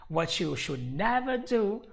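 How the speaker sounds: ordinary talk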